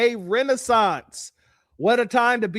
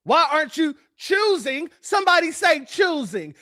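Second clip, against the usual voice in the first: ordinary talk, nothing marked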